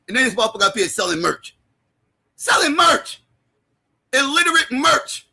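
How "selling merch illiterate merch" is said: raised, high-pitched scornful disbelief; high, derisive exclamation; incredulous mockery. Real, not acted. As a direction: scoff at it in a higher voice. Mocking rant about website merch.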